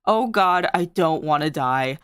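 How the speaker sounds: ordinary delivery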